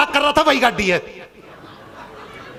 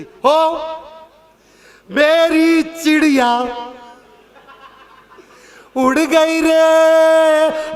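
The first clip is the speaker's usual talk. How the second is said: very slow, higher, louder sung-out wail; grandma's wailing lament; 3 semitones higher, 5 dB louder, much slower than usual. Retold or imitated. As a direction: stretch it like an old woman's farewell keen. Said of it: "दादी ने रोना किया".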